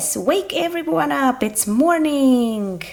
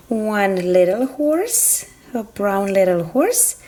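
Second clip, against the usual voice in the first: ordinary talk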